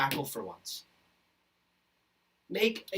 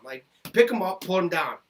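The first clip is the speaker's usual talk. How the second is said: ordinary delivery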